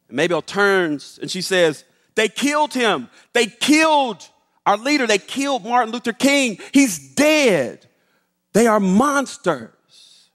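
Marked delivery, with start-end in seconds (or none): 2.1-10.3 high, raised cry of grief; retold outcry, hammering each loss; anguished grief. Maid telling the boy of King's death, anguished. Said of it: "she's sobbing inconsolably".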